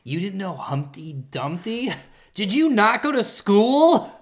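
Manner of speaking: slow, incredulous scorn, rising high and loud; mock-berate a teammate, stretching each word; mean mockery. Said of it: "how mean I get".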